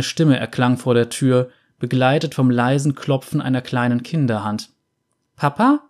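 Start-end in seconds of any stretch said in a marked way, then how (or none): 5.4-5.8 put-on high, bright boy's call through the door; cheerful child calling. A narrator's reading. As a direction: call out to dad happily. Boy calls his father in the morning.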